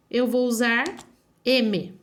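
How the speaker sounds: measured words, pause before M; slow dictation. Shown as a demonstration. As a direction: dictate it piece by piece. Dictates the rule while writing. (ditado devagar e pausado)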